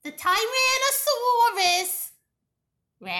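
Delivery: "Tyrannosaurus", long pause, then "Rex"; dramatic pause before Rex. Shown as a demonstration. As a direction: hold a beat before "Rex". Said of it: "this dramatic pause before Rex".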